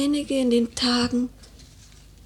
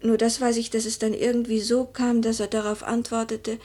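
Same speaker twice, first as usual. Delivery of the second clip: normal talking voice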